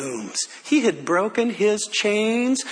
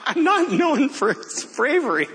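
high, chuckling aside; amused, giggly delivery; laughing; 8 semitones higher than usual. Real, not acted. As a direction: say it through a real laugh. Laughing at his own story.

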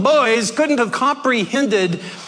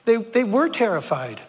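ordinary talk

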